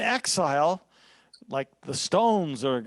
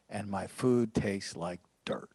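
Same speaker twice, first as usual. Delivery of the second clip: whisper; 8 semitones lower, 7 dB quieter than usual. Acted. Voicing the psalmist's lament.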